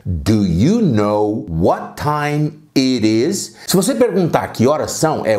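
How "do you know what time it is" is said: slow, careful English with a rising end; deliberate teacher demo; slow model question. Shown as a demonstration. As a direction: model it slowly for the class. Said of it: "a pergunta é".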